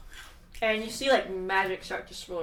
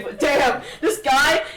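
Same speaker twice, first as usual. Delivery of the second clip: usual speaking voice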